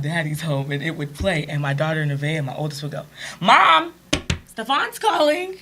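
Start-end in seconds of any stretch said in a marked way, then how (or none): 3.4-5.6 put-on high, loud little girl calling mom, slowed on the name; child calling out. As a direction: call out like an excited kid announcing a caller. Daughter calling out the caller.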